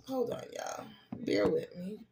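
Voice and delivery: a quick hushed aside while busy; whispered aside. Real, not acted. Reader whispering to viewers while adjusting the camera. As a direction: whisper it, half to yourself. Fixing the camera mid-stream.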